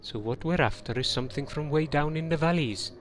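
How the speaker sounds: put-on lilting Welsh valleys accent. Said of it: "Maybe if you did it in a Welsh accent".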